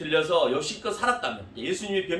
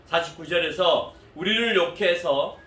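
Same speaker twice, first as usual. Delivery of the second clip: ordinary talk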